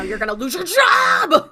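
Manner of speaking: loud, slow, drawn-out mock shout; hammed-up angry yell. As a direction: bellow the threat like a furious boss. Resident yelling at the doctor.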